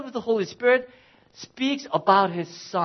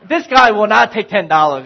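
ordinary delivery

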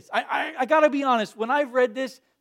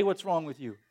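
lower, softer, offhand "What's wrong with you?"; dismissive; 7 semitones lower, 7 dB quieter than usual. Retold or imitated. Pastor describing easy judgement of the Israelites, offhand dismissal. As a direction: toss off "What's" casually. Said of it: "it's easy to just say".

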